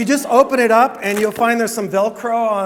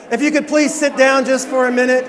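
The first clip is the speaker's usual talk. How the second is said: calling out; 5 semitones higher, 5 dB louder than usual. Real, not acted. Calling noisy room to sit.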